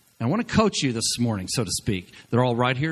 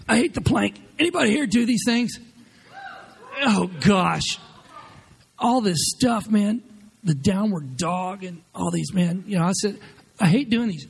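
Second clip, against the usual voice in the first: mock whining; 7 semitones higher than usual. Complaining about exercise.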